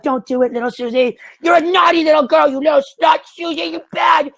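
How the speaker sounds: hammed-up high nagging friend voice; mocking friend. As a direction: squeal it like a prissy friend talking her out of it. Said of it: "her friend's like".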